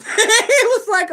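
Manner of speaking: high, loud, slow laughing through the words; helpless laughter; cracking up mid-story at the suitor's reply; laughing hard. Real, not acted. Laughter overtakes the telling.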